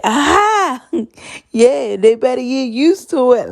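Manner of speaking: high, loud echo through laughter; laughing loud repeat. Retold or imitated. Husband cracks up repeating his wife's words, laughing loud. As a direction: crack up while echoing the line.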